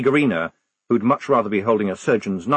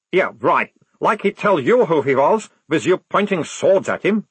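higher, louder, taunting soldier; cocky captive sergeant; 7 semitones higher, 5 dB louder than usual. A narrator's reading. Prisoner needles lieutenant about the monstrous regiment, cocky. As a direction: relish the gossip as the cheeky prisoner.

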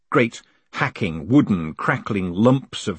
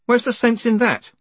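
exasperated; 14 semitones higher, 4 dB louder than usual. A narrator's reading. Moist exasperated at filled offices.

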